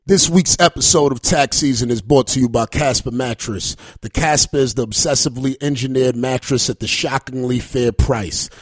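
smooth, even sponsor read, steady pace; host reading a sponsor ad mid-podcast. Acted. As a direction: sell the mattress like a radio spot.

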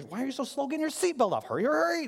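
high, pushy rushing dad voice; impatient dad. Retold or imitated. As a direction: hurry the kid along, exasperated. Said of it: "It's like, you're".

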